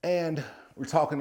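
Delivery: normal talking voice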